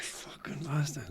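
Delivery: whispered curse under the breath; muttered swear. Retold or imitated. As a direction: hiss the swear at the player, unvoiced. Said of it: "I was like".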